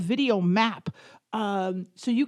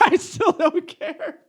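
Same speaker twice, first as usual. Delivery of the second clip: slow, higher, through laughter; laughing echo; 6 semitones higher, slower than usual. Retold or imitated. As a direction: repeat it mockingly while laughing. Echoes husband's line, laughing.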